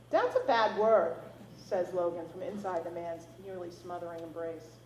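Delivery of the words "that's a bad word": put-on little boy voice. A narrator's reading. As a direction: scold like a prim toddler. Toddler scolds grandpa.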